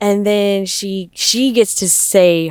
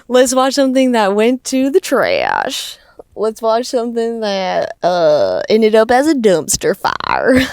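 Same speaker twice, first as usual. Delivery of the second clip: high, loud mock-tearful whine, half-laughing; hammed-up crybaby wail about the dumpster fire; laugh-cry parody of a heartbroken bride; 4 semitones higher, 6 dB louder than usual. Mocking Jen's teary tape intro.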